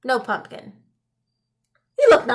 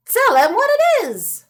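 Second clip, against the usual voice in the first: put-on high, loud old woman voice; lightly voiced old woman prompting; old woman suggesting; 11 semitones higher, 6 dB louder than usual. Old woman urges her husband, high and loud. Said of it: "suggested the old woman".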